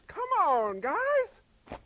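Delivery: high, loud mock pleading; coaxing exhortation. Preacher voicing God urging his people, high loud coaxing. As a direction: pitch leaping up at the end, hammed-up urging.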